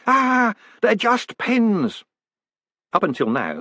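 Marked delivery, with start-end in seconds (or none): 0.1-2.0 high, slow drawn-out shout. A narrator's reading. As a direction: fling it out in a wail. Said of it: "run out shouting".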